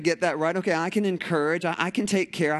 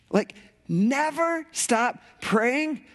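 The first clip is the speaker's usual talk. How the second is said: incredulous question; 7 semitones higher, much slower than usual. Retold or imitated. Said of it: "anybody else going".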